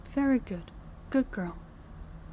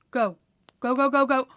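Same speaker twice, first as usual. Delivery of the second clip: loud, raised, rapid-fire urging; louder and higher, impatient command; urgent hurrying; 4 semitones higher, 5 dB louder than usual. Acted. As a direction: shoo a servant off in a hurry. Hurrying servant to change clothes.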